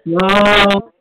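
booming astonished wow; loud shocked wow. Real, not acted. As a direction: blurt it out in shock. Reacting to unpaid promoter story.

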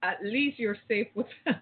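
ordinary talk

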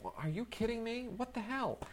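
a stunned fan who can't believe it; incredulous disbelief. Retold or imitated. Critic mocks his own shock at the director's new film, high incredulous squeak.

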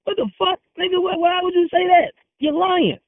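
heated, high-pitched outburst; real indignation. Real, not acted. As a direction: fire back in high-pitched outrage. Indignant retort to accusation of lying.

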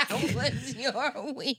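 slow, laughing repeat of the question; amused slow echo. Real, not acted. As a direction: drag it out through a laugh. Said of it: "You tried it".

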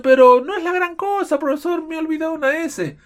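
high, loud whiny student voice; mock student excuse. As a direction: play a careless student brushing it off. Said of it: "Y los alumnos dicen". (alumno quejándose con voz aguda y burlona)

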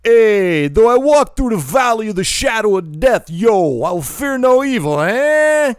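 scripture shouted in a hammed-up Jersey accent; caricatured New York-Jersey accent. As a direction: belt it high and loud as a bad New York wise guy. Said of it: "I hear something akin to".

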